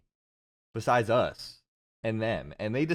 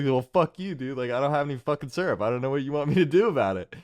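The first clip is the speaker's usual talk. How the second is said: higher, louder mock outburst, rattled off fast; retold angry comeback; 4 semitones higher, 4 dB louder, faster than usual. Waiter snaps back at customer over syrup, retold angry. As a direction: imitate a fed-up waiter firing back.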